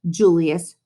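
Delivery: plain reading voice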